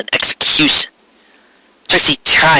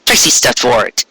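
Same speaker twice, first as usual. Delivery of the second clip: ordinary delivery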